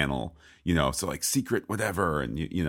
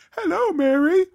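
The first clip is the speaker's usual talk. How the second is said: very high, louder falsetto mock voice; mock French courtier; 7 dB louder than usual. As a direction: parody the courtiers in a squeaky falsetto. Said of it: "everyone's like".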